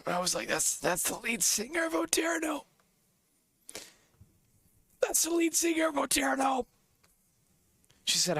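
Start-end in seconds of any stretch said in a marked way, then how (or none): 0.5-2.6 hushed, much higher whisper of disbelief; stunned whisper. Retold or imitated. Telling his friend who the opener was, in a stunned whisper. 5.0-6.7 excited shout. Retold or imitated. Telling his friend who the opener was, shouting in excitement.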